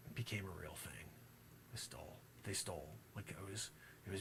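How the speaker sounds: hushed conspiratorial whisper; secretive whisper about the stolen vote. Acted.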